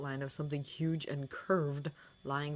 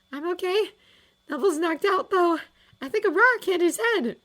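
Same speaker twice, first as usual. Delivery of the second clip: put-on girl voice; 11 dB louder than usual. A narrator's reading. Julia voiced high.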